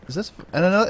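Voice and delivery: ordinary delivery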